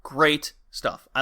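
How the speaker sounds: usual speaking voice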